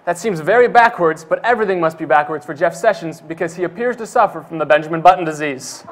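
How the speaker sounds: ordinary delivery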